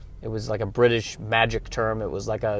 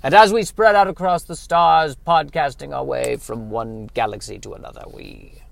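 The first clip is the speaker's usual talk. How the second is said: mystic elder sage; 4 dB louder than usual. Acted. Reverend Mother tells the child podcasting's cosmic history, hammed-up. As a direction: intone it like an ancient oracle, then wail.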